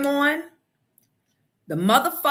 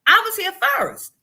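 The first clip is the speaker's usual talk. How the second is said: indignant; 9 semitones higher, 6 dB louder than usual. Retold or imitated. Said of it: "I'm like".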